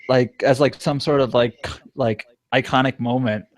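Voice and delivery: ordinary talk, nothing marked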